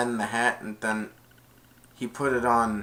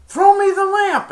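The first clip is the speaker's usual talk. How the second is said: much higher, louder command; villain demanding the lamp; loud high demanding order; demanding shout; 12 dB louder than usual. Retold or imitated.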